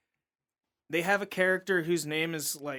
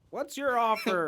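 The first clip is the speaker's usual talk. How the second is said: put-on higher gruff old farmer voice; Eustace impression; 7 semitones higher than usual. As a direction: snap it like a grumpy old man. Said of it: "Eustace is just like".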